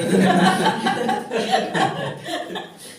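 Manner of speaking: long, loud burst of laughter. Real, not acted. Kindergarten teacher amused by her own joke, loud laugh.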